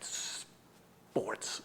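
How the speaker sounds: drawn-out sheepish whisper; barely audible admission. Retold or imitated. Wife asks him to repeat, he whispers. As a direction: hiss out the first sound, whispering. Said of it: "I didn't hear that".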